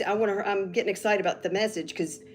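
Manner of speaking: ordinary delivery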